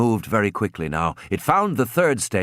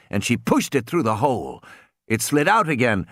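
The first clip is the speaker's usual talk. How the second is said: ordinary delivery, nothing marked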